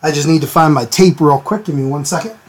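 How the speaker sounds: ordinary delivery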